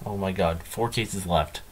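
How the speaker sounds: usual speaking voice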